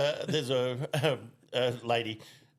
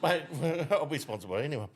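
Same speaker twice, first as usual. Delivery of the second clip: ordinary talk, nothing marked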